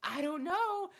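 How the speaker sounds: put-on flustered girl near tears; high panicked mock cashier; panicked worker. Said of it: "And she's like".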